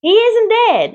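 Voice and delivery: high, loud holler. A narrator's reading. Farmer shouting that his pig is alive, loud holler. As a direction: shout it across the ring. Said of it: "hollered Zuckerman".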